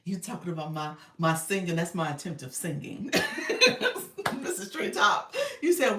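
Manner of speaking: ordinary talk, nothing marked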